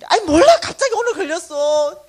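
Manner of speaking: high, loud, near crying; desperate wail. Retold or imitated. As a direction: plead as if about to cry. Manager near tears pleading. (지배인이 울먹이며 크게 하소연)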